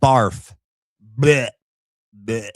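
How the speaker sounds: loud gagging blech; disgusted by taste. Real, not acted. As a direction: spit out the word like bad food. Reacting with disgust.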